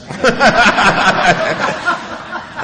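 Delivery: loud laugh. Real, not acted. Speaker to crowd about his bragging father, loud laugh. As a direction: laugh at the annoyed customers. Bartender says customers annoyed.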